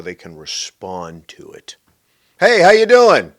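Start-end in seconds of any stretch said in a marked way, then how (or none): 2.4-3.3 high, loud breezy hello; exaggerated fake-friendly holler; mock hearty greeting. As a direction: call it out cheerily as if walking past. Mimics hollow greeting in passing.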